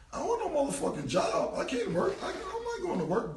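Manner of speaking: high, mock-outraged protest; indignant refusal. Imagined answer to Dame's job offer, high indignant protest. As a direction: refuse it like it's an insult.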